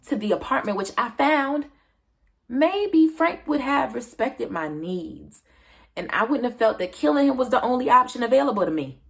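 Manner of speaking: acted heated justification; worked-up self-justification. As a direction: insist on it, pushing harder toward the end. Character justifies the killing, worked up.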